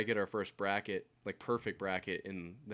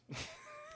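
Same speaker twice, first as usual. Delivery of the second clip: high laugh; 13 dB quieter than usual. Real, not acted. Laugh after awkward pause.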